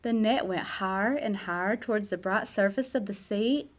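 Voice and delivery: ordinary delivery, nothing marked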